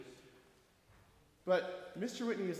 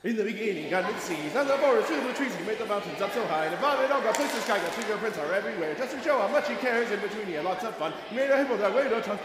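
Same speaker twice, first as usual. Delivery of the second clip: rushed fast sung verse; faster; 6 dB louder, much faster than usual. Pretend. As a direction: race through the song. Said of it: "be prepared to go faster".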